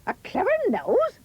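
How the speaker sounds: very high incredulous echo; scornful query. A narrator's reading. Mother doubting Jack's giant story, scornful question. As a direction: throw it back as a rising question. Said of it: "his mother hissed".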